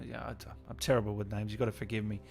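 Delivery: ordinary delivery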